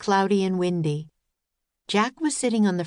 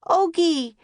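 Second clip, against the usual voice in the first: high loud call; 11 semitones higher, 4 dB louder than usual. A narrator's reading. Calling for the puppy in the woods. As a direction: call out through the trees. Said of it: "they called".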